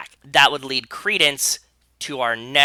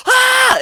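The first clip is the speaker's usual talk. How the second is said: high excited scream, much louder; imitated scream; 9 dB louder than usual. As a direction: shriek like a fan at the TV. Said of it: "she's like".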